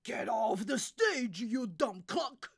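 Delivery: loud, angry jeer; heckling shout. Acted. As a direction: shout the performer off the stage. Audience member shouts jeer.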